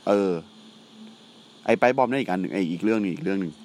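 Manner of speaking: ordinary delivery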